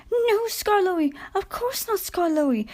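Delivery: quiver it like scolded coaches; meek quivering coaches. A narrator's reading. Coaches cowering before Skarloey, high and meek. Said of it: "quivered the coaches meekly".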